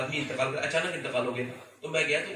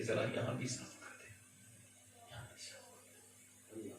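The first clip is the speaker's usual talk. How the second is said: hushed, fading imagined plea; barely voiced, as if unable to ask; helpless plea; 15 dB quieter than usual. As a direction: let the request sink almost to a whisper. Imagined helpless plea on washing slab.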